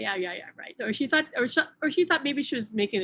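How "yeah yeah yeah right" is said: low-key, softer brush-off; imitating someone brushing off a claim; dismissive shrug-off. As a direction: shrug it off with a quiet yeah-yeah. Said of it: "shrugged it off, like".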